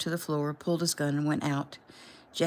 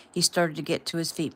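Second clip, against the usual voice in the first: usual speaking voice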